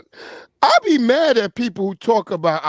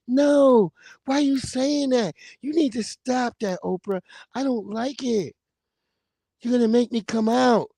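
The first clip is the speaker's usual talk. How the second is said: soft but firm, lightly higher voice; soft-voiced singer; 3 semitones higher than usual. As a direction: lightly imitate a soft-spoken man standing his ground. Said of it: "he just always had that soft voice".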